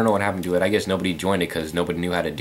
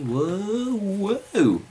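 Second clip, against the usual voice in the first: mock surprise; 12 semitones higher than usual. Real, not acted. Playing in the dark.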